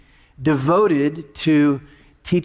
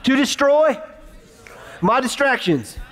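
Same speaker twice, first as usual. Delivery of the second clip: higher, leading the crowd in a chant; call and response; 6 semitones higher than usual. Shown as a demonstration. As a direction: lead the congregation line by line. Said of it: "say this with me".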